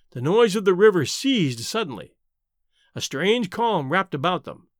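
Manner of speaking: ordinary delivery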